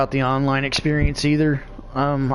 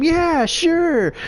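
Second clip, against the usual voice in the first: much higher, easygoing yes; keen agreement; 13 semitones higher than usual. Retold or imitated. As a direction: happily go along, pitched up high. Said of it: "and he's like".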